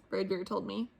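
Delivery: ordinary delivery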